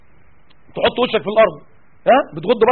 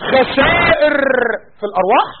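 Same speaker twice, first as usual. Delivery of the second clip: outraged shout; 4 semitones higher than usual. Real, not acted. Preacher outraged at calling martyrs losses, shouting. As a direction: throw the word back in outrage.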